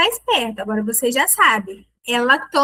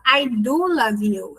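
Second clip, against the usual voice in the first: "do" drawn out and stressed in "I do love you"; slow insistence on "do"; emphatic do; slower than usual. Shown as a demonstration. Reassuring someone who doubted the love, emphatic do. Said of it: "de intensificação".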